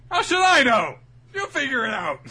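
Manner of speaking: high, loud dismissive yell; mock impatient shout; brusque shouting. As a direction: brush them off with a hammed-up holler. Luffy brushes off her tearful question.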